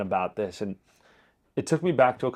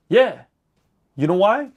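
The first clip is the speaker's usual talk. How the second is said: proud retelling; 6 semitones higher, 6 dB louder than usual. Retold or imitated. Proudly retelling telling off team.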